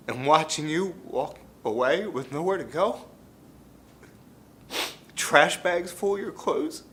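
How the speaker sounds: high-pitched, pained recollection; anguished. Acted. Father recalling his son leaving with trash bags, anguished. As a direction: strain upward with heartache, halting between phrases.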